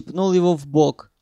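usual speaking voice

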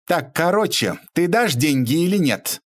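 high, impatient demand; getting angry. A narrator's reading. Lady pressing Bobrov for money, starting to get angry. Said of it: "Геля начинала злиться".

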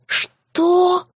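high shocked yelp; shocked cry. A narrator's reading. Maya shrieks at the demon living in her flat, shocked cry. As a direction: cry out in disbelief. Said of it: "Мой крик разорвал тишину ночи".

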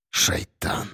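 whispered curse to himself. A narrator's reading. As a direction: hiss it under your breath. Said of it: "прошептал он про себя". (шёпотом про себя)

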